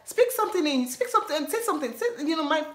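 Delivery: high, fast, fumbling invitation; imitating fumbling suitor. College guy fumbling an invitation, high and fast. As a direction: stumble through asking her out. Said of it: "So they said that".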